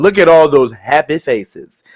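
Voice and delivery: ordinary talk, nothing marked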